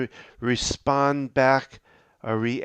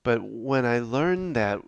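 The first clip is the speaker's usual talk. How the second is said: ordinary talk, nothing marked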